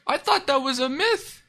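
high-pitched astonished exclamation; amazed disbelief. Real, not acted. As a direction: squeak it out, floored by the news. Astonished at internet in juvie.

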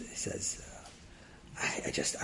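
unvoiced, hesitant whisper. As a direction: whisper it, unsure.

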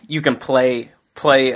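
usual speaking voice

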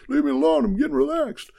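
high, loud mock protest; mock annoyed self. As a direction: whine at the people outside to go away. Himself answering people outside, mock.

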